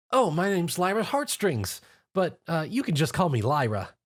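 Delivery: bright eager introduction; cheerful. A narrator's reading. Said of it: "cheerfully introduced herself".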